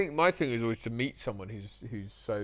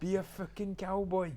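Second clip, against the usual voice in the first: higher-pitched Welsh order; Mark Price; 8 semitones higher than usual. Retold or imitated. Director tells actor to be a cowboy, Welsh accent. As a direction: urge him on in broad Welsh. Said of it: "He said".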